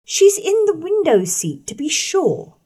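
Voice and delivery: put-on high girlish telltale voice; tattling girl. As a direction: sing out the hiding place smugly. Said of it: "Eliza just put her head in".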